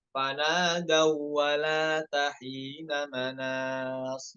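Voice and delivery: slow chant of "Fanadau wala tahina manas"; "Fanadau" drawn out slowly; recitation. Shown as a demonstration. Teacher repeating the verse slowly for students. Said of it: "Hanya idgham aja masuk".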